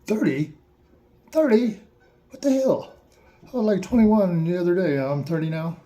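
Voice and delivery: a mock freak-out that climbs and then settles; shocked disbelief. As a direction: act out the panic of suddenly being thirty. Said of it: "It's like".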